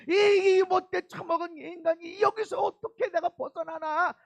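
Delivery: loud anguished crying cry of repentance; wailing lament. Acted. Preacher voicing a sinner's loud wailing lament. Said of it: "하는 그런 통곡이 일어나는 거야".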